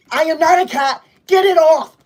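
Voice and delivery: loud high protest; indignant shout. Real, not acted. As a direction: yell at the gift on screen. Cat gift on screen.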